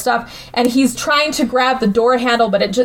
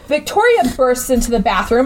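ordinary delivery

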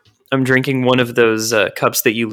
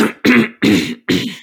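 loud throat clearing; 10 semitones higher, 3 dB louder than usual. Real, not acted. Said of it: "clear my throat really loud into the mic".

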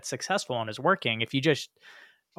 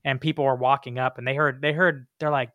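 ordinary talk

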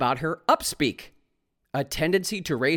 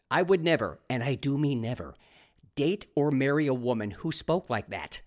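ordinary talk, nothing marked

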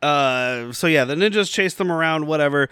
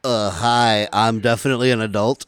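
faking an adult; 6 semitones lower than usual. Acted.

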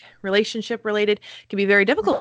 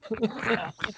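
squeaky gleeful gremlin giggle; 8 dB quieter than usual. Acted. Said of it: "That's my gremlin noises".

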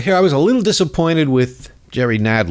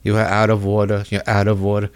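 mock Nadler; 4 semitones lower, faster than usual. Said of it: "Nadler just kept saying".

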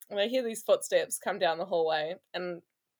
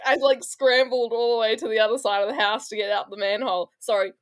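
ordinary talk

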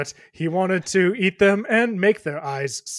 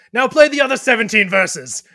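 loud, raised demand; 6 semitones higher, 9 dB louder than usual. Acted. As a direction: shout the request. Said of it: "your friend says".

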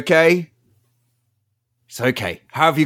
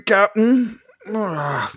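ordinary talk, nothing marked

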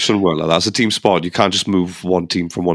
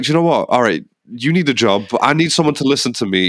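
higher, breezy mock owner voice; imitating the owner; 3 semitones higher than usual. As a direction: play the owner cutting a cheeky deal. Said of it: "Todd Bowley's like".

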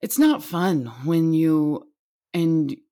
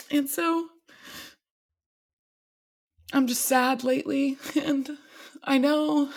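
near tears; 7 semitones higher than usual. Real, not acted. Young mom talking about co-parenting, tearing up. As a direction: voice pitched up high and fragile, on the edge of crying. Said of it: "God, I'm gonna cry".

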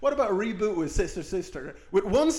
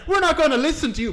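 mock defiant students; 11 semitones higher, 6 dB louder, faster than usual. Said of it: "she's like".

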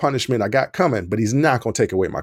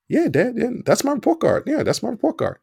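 put-on much higher, a guilty kid overselling it; nervous insisting; 11 semitones higher than usual. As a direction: keep repeating it high and eager. Son nervously insists to dad.

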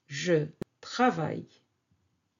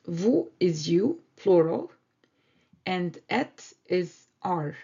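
ordinary talk

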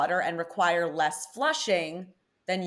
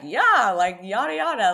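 imitating Irish date; 3 semitones higher, 5 dB louder than usual. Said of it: "he was just like".

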